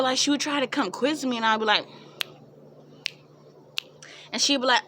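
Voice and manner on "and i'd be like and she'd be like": ordinary delivery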